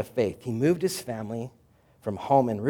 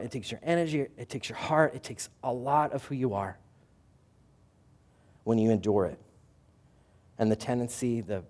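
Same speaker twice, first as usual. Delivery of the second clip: usual speaking voice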